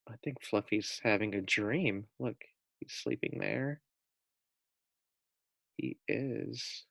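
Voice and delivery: soft, hushed voice; quiet so he stays asleep; hushed aside. Real, not acted. Teller whispering to class that Fluffy sleeps, hushed.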